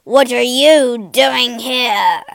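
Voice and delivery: slow, very loud monster roar; beast's roar. A narrator's reading. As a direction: roar it out at the intruder. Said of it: "roared the beast".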